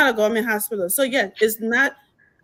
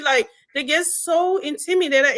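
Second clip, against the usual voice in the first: ordinary talk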